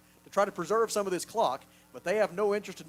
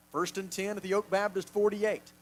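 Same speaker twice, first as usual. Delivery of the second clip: ordinary talk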